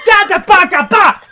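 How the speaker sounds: loud, high baffled babble; da-da-ba sputter, loud and laughing; sputtering gibberish. Real, not acted. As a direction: lose the words in laughing outrage. Sputtering in disbelief.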